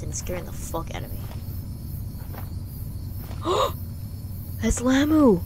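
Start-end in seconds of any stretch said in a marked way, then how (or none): 1.2-4.6 high, long frightened gasp; terrified gasp. Real, not acted. Player spots Lamu, terrified gasp. Sees monster in game. 4.6-5.4 high, very loud alarmed cry; panicked shout. Real, not acted. Player spots Lamu, panicked shout. Sees monster in game.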